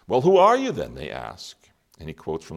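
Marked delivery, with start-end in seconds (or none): 0.1-0.9 much higher and louder, a demanding challenge; challenging question. Acted. Religious leaders pressing John, loud incredulous question. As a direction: priests confronting John, a sharp demanding question.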